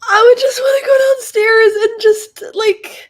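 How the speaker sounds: choked sob rising into a cry. Real, not acted. Crying again, voice high.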